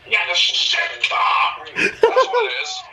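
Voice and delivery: high, loud jeer through laughter; mock the tone while cracking up; laughing shout. Real, not acted. Joking insult of the tone.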